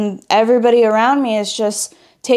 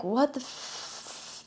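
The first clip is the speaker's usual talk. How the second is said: much softer, slow, near-whispered shock; stunned disbelief; 2 semitones higher, 16 dB quieter, much slower than usual. Retold or imitated. Reacting to girls loving basketball, hushed slow disbelief. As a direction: let it fall out under your breath. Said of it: "And I was just like".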